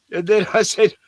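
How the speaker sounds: usual speaking voice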